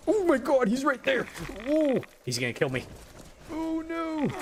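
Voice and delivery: high, loud panicked cries; panicking gamer. Real, not acted. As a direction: yelp as the crab closes in. Hermit crab chasing player.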